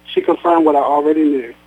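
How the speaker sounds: ordinary delivery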